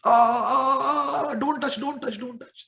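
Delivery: high, crying moans of pain; moaning in pain. Acted. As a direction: cry out as if hurt, fading away. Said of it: "starts moaning in pain".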